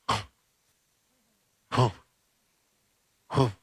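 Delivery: breathy huh huh of hopping; short hopping grunts. Acted. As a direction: puff with each hop. Lazarus hopping out, bound.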